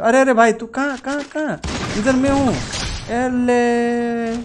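sudden high alarmed calls; real surprise mid-fight; startled. Real, not acted. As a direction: caught off guard, looking for the enemy.

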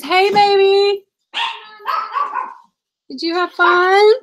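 high, slow sing-song cooing. Real, not acted. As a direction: coo at the little one on camera. Cooing to her child.

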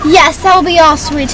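put-on British accent. Finishing the order with the barista, British accent. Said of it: "that accent when you order your Starbucks".

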